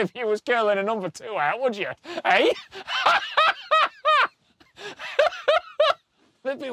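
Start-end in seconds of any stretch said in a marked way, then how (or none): 2.6-4.3 high cackling laugh. Real, not acted. Laughing at toilet joke.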